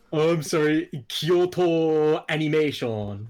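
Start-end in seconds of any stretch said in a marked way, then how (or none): 1.1-3.3 "Kyoto Animation" drawn out slowly, syllable by syllable; over-careful correction. Real, not acted. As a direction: pronounce "Kyoto Animation" with exaggerated care. Over-careful correction after being mocked.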